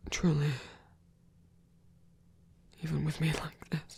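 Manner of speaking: fragile, tender whisper. Acted. A vulnerable god whispering as he asks for reassurance. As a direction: ask it barely aloud.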